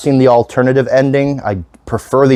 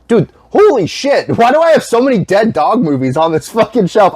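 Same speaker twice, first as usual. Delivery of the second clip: surprised; 10 semitones higher than usual. Real, not acted. Shocked at his dead dog shelf.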